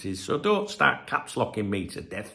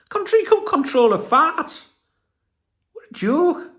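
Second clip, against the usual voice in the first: much higher and louder, slow scornful blast; angry outburst; 5 dB louder than usual. Real, not acted. Fan scorns Newcastle journalists, loud, high, angry. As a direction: explode with scorn. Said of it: "Why am I getting so angry?".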